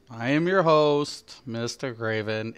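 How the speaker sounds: numb tongue after root canal; numb from the dentist. Real, not acted. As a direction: speak with half the tongue numb. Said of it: "I sound way different".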